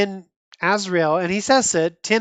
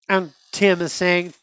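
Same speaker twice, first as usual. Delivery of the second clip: ordinary talk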